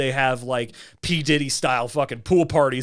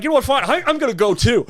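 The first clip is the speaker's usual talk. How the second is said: raised, higher retort; retold angry comeback; 7 semitones higher, faster than usual. Storming after his friend, a raised huffy retort. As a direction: fire back in a huff. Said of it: "and I'm like".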